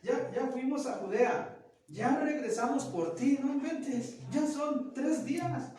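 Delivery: high, loud scolding, slowing at the end; Mary scolding young Jesus, mock furious and high; furious mother. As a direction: tell off the boy who vanished for days. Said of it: "la Virgen María iba encabronada".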